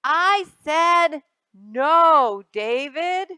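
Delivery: loud, very slow reprimand; exasperated loud mother's no; loud scolding. Acted. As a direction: put your foot down hard.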